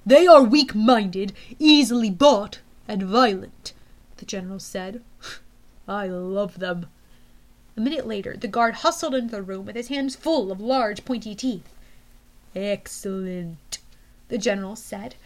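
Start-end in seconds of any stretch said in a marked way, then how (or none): 0.1-3.7 menacing general. Acted. 5.2-6.9 menacing general. Acted. General's dark chuckle. 12.5-13.8 low slow menacing. Acted. General's voice.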